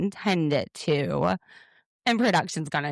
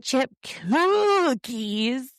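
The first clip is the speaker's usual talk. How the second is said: Muppet voice; 8 semitones higher, much slower than usual. Retold or imitated. Host mimicking Robin announcing her cookies, hammed-up Muppet voice. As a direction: whoop it like Cookie Monster, stretched out. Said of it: "she sounds like a Muppet".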